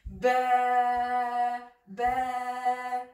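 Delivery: Ba drawn out, neither light nor heavy; "ba" allongé, entre les deux; long in-between ba. Shown as a demonstration. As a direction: stretch Ba, between the two. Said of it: "C'est entre les deux".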